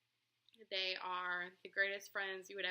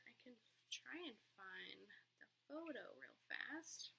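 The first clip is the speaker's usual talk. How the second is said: soft unvoiced aside to herself; whisper; 11 dB quieter than usual. Real, not acted. Vlogger to herself, hunting a photo, whispered. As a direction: whisper it while scrolling for the photo.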